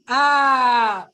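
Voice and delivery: loud, drawn-out "aah"; a long gotcha "ah" on catching someone out; loud knowing exclamation; teasing "ah". Real, not acted. Catching parents following her action.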